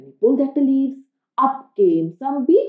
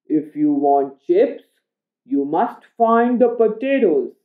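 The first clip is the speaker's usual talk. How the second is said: grandpa making a deal. Acted. Grandpa making a bargain with Oliver, lightly voiced. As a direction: set the terms like a kindly grandpa.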